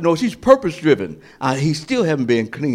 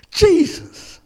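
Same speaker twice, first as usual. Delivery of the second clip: high, louder exclaim; joyful cry; 5 dB louder than usual. Acted. Mary recognizing Jesus, crying out joyfully. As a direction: cry out the name in joy.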